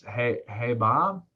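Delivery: unhurried, slowed-down phone opener; slow delivery. Shown as a demonstration. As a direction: take your time, match a slow caller. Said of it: "You see how much slower that is?".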